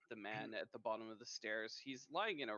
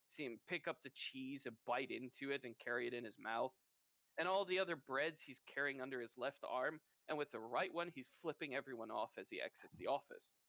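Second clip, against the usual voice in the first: ordinary talk, nothing marked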